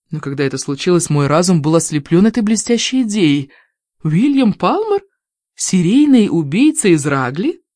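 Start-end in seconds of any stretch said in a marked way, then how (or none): 4.0-7.6 delighted surprise. Acted. Delighted realisation.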